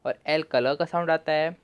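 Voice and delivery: usual speaking voice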